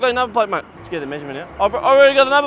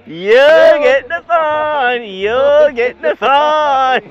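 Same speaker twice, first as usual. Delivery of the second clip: drawn-out, louder, higher mocking chant; slow teasing victory chant at the driver; gloating sing-song; 5 semitones higher, 7 dB louder, slower than usual. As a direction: rub it in slowly, singing it at him.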